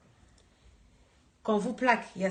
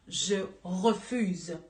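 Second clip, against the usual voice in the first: ordinary talk, nothing marked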